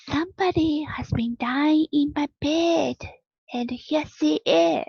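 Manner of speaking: little bear crying out in alarm; cried out. A narrator's reading. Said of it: "But then he cried".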